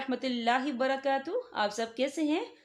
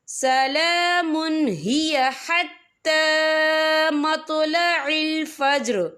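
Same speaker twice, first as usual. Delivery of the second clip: slow, drawn-out tajweed chant; Quran recitation; 3 semitones higher, 8 dB louder, much slower than usual. Shown as a demonstration. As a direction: recite it slowly and melodiously. (धीमी तिलावत)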